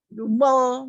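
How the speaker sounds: ordinary talk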